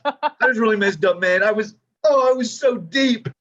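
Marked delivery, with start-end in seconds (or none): none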